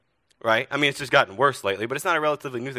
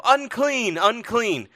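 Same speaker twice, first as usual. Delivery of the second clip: high, loud warning cry; yelling; 9 semitones higher, 6 dB louder, much slower than usual. Acted. Leper warning townspeople on coming near, yelling. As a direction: call it out as a warning to all. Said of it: "you'd have to yell".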